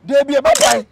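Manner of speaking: loud insistent denial; mock shout. Arguing back with the friend, a loud denial. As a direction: shoot the denial straight back.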